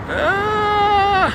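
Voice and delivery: sharp yelp leaping way up; a startled high shout of ah; quick surprised high cry; sudden high yelp. Real, not acted. Startled mid-sentence on stream.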